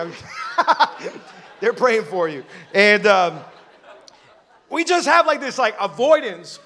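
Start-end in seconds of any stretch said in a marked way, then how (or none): 0.2-1.5 quick breathy chuckle; short laugh between words; airy laugh. Real, not acted. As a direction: laugh through the line. Laughs mid-sentence after a joke.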